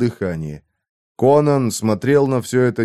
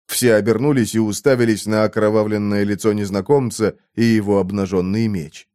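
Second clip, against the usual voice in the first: plain reading voice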